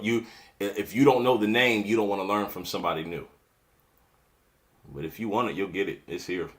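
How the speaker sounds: ordinary talk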